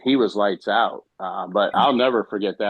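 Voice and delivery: usual speaking voice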